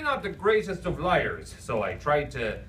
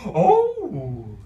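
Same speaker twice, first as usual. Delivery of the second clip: goofy clown voice; 6 dB louder than usual. Acted. Clown Lou voiced by reader.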